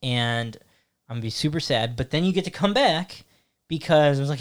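ordinary delivery

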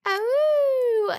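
high, sustained mock wolf howl; long, high-pitched "awoo". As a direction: howl playfully like a werewolf. Imitates a werewolf howl.

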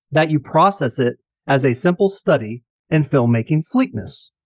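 usual speaking voice

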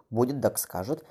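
usual speaking voice

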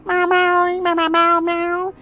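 string of high, level meows; cat meow imitation. Kitty boasting of its new home, meowing away.

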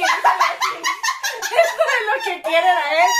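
slow, drawn-out words through loud laughter; laughing. Real, not acted. Joking about a lustful reality cast.

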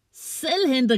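laughing. Acted. Anton teasing Malte after the miss, high gleeful jeer. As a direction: tease him with a high gleeful cry. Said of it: "griner Anton".

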